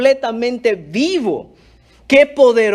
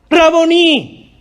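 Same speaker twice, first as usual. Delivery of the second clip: loud, high, drawn-out cry; shout of recognition; 7 semitones higher, 6 dB louder, much slower than usual. Acted. María turns and recognises Jesús, shout of recognition. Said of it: "Le grita también".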